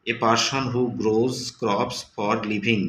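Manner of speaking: very slow, word by word; slow reading aloud. Shown as a demonstration. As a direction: stretch every word of the definition. Teacher reading definition. (খুব ধীরে পড়া)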